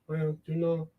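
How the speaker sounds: put-on low, soft Spanish accent with halting pauses; awkward, quiet Spanish-accented voice; Pedro. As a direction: mumble shyly in a Spanish accent. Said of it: "I'm gonna do the accent".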